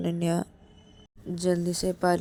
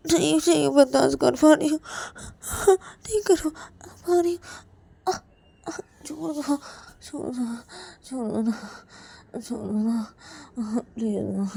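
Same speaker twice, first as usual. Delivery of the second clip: tearful pleading; 8 semitones higher than usual. Acted. Payal cannot bear it, begging.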